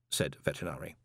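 plain reading voice

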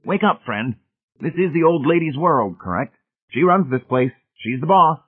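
blunt, high-pitched know-it-all; blunt party guest. Acted.

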